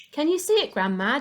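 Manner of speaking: high, thrilled call up to the roof; excited shout. A narrator's reading. Boy calling up to grandma on the roof, high and excited. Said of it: "George shouted".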